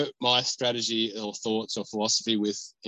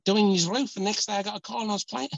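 high-pitched, badly faked Scouse; hammed-up Liverpudlian accent, pitched right up; Craig Noone; 9 semitones higher than usual. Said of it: "Oh, sorry, that's really bad".

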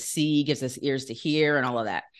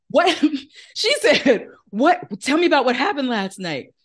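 excited, laughing; 7 semitones higher, 4 dB louder than usual. Retold or imitated. Laughing retelling of sister's excitement.

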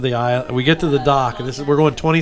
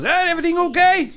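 high, lightly put-on Swiss accent calling from a boat; a boatman calling out in a Swiss accent. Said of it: "he's got a Swiss accent".

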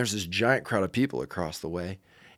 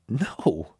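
uneasy, drawn-out no; weirded out. Retold or imitated. Girl at the counter questioned by a stranger, weirded out. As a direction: answer as if getting uncomfortable with the questions. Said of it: "getting weird about it".

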